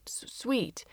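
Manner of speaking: soft unvoiced whisper. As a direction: breathe it to the kids. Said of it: "he said and pointed".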